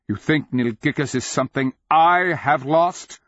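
high, loud challenge; calling down. A narrator's reading. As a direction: call down from above, rising on the question. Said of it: "the Nonman king called down".

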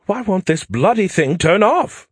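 high, slow British complaint; an Englishman fumbling with his phone, British accent. Acted. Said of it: "the voice said with a British accent".

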